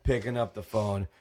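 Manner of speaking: normal talking voice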